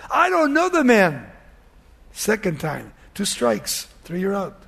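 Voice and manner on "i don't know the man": high, insistent denial falling at the end; lightly acted heated denial; emphatic denial. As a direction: protest it, pitch jumping up. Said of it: "he denied an oath".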